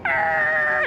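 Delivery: high, loud sudden yelp; alarmed cry. Real, not acted. Commentator alarmed as the rider backs it in.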